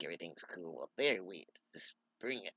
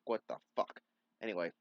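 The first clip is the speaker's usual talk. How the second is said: usual speaking voice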